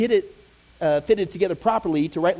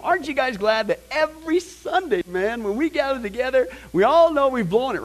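raised, hammed-up eagerness; mock enthusiasm; 5 semitones higher than usual. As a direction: pretend to rally a glad congregation. Playing eager about Old Testament sacrifices.

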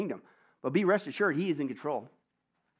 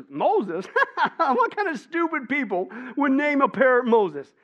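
higher, louder, laughing scorn; mocking laugh; 10 semitones higher, 8 dB louder, slower than usual. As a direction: laugh it off as ridiculous. Burglar mocks parrot's name.

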